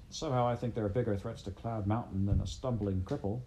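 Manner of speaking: ordinary delivery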